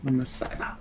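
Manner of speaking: whisper. Real, not acted. Whispered aside.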